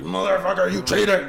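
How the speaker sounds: mock-angry "you cheater" shout; angry yell. Angry guy at the blackjack table.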